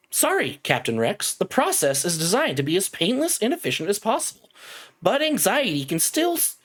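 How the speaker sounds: high-pitched, singsong automated voice; synthetic, measured announcement pitched well up; robotic announcer. Acted. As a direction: recite it like a cheery corporate computer. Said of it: "that same robotic voice".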